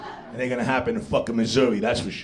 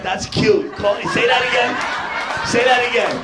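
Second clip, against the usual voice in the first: much higher, louder taunting dare; mocking high challenge; 9 semitones higher, 4 dB louder than usual. To the guy who called him a fucker, a high loud taunt. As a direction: squeal say that again at him, daring. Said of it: "I was like".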